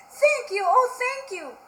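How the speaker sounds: high, loud, gushing thanks; grateful witch. Acted. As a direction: cry out your thanks. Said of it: "the grateful witch cried".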